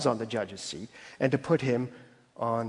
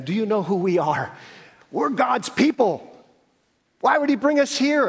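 indignant protest; 8 semitones higher, 4 dB louder than usual. Retold or imitated. Israel demanding answers from God, indignant and much higher. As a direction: protest like offended royalty. Israel voiced as entitled complainers.

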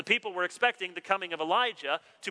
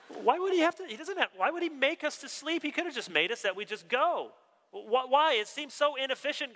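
high-pitched mock bewilderment; hammed-up baffled protest; incredulous questioning; 8 semitones higher than usual. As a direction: question God in high, exasperated disbelief. Acts out questioning God about sleep.

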